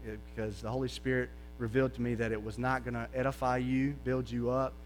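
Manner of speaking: soft sincere apology. Shown as a demonstration. Pastor models a quiet, humble apology to Greg. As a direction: quietly ask a brother's forgiveness.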